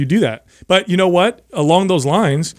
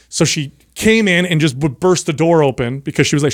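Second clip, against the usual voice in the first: normal talking voice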